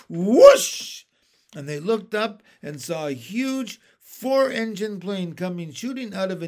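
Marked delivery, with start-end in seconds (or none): 0.1-1.0 loud rushing whoosh. A narrator's reading. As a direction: blast the whoosh out. Plane rushing past.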